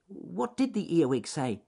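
high, eager rabbit question. A narrator's reading. Ernest asking Alec for the punchline, high eager voice. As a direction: pitch it up and ask keenly.

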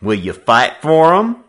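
loud, high, rising challenge; challenging counter-demand. A narrator's reading. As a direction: dare him to fight. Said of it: "was Simpson's counter-demand".

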